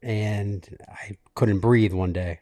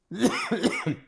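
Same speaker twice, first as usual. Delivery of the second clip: real cough; 11 semitones higher than usual. Real, not acted. Coughing while telling cancer story.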